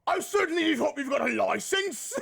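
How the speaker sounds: British copper voice, laughing through it; a jokey English bobby asking for papers; mock British cop. Joking with the chat as a British cop, laughing. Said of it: "Listen to fucking the cop".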